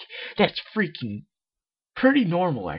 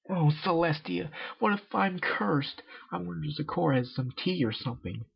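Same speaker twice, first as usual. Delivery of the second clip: put-on higher, anxious young pony voice; worried Rainbow Dash; 6 semitones higher than usual.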